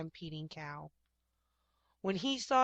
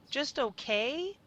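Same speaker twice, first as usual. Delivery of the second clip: slow high disbelieving question; incredulous; 6 semitones higher, 4 dB louder, slower than usual. A narrator's reading. Rob pressing his cow, slow and incredulous.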